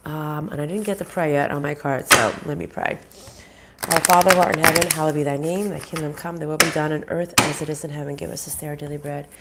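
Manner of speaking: ordinary talk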